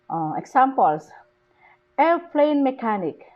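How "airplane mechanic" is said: "airplane" high on "air", falling through "mechanic"; stress on AIR. Shown as a demonstration. Teacher shows compound nouns, stress on "air". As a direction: hit the start of "airplane". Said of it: "The stress is on the word air".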